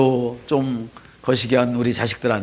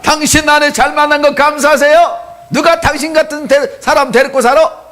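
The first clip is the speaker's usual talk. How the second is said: much higher and louder, 당신 아내 잘 만난 거 감사하세요 belted out; loud teasing shout; 14 semitones higher, 10 dB louder than usual. Shown as a demonstration. Pastor leads the congregation, bellowing a teasing rebuke. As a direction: shout it at the neighbour with playful scolding. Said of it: "시작".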